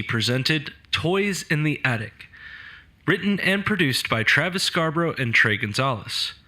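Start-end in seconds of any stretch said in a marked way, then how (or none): none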